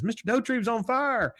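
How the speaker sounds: put-on high-pitched kid's alarm; mock alarmed cry. Bobby sees the neighbour burning, high alarmed cry. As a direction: pitch it way up like a worried boy. Retold boy raising the alarm again.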